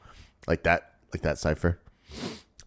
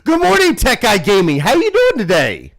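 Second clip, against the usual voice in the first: excited greeting; 9 semitones higher, 4 dB louder than usual. Real, not acted. Greeting a viewer loudly.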